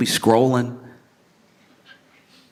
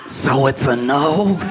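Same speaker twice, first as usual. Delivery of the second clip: slow, higher, rising comic question; hopeful rejected suitor; 4 semitones higher, slower than usual. Acted. Man on a stretcher to his date, acted feeble hope. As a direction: ask it slowly, still clinging to hope.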